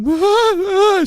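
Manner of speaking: high-pitched mock wail; exaggerated high crying noise; imitated crying. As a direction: imitate her hysterical sobbing. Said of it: "she starts, like, crying".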